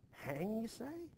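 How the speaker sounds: shocked, can't believe the word; shocked disbelief. Acted. Wife shocked that a neighbour will hang. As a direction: voice leaps high on say.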